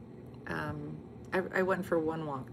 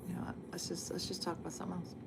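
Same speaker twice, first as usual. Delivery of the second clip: hushed whisper; 13 dB quieter than usual. Real, not acted. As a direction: keep it down, whisper. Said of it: "why are you whispering?".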